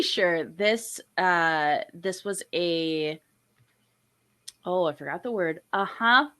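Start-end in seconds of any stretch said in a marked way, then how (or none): none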